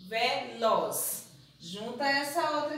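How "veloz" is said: "Veloz" joined, the z kept with the vowel; blended word. Shown as a demonstration. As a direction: say "Veloz" in one go. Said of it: "o Z não pode separar da vogal". ("Veloz" tudo junto)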